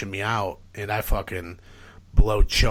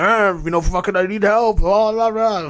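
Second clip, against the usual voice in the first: high, loud babbled cry for help; hammed-up panicked pleading; frantic plea; 11 semitones higher, 8 dB louder than usual. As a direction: imitate yourself wailing incoherently for help.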